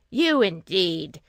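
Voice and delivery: slow, loud, mocking scorn; scornful cry. A narrator's reading. Sisters mocking Two Eyes, loud and scornful. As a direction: sneer it down at her. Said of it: "cried her sisters".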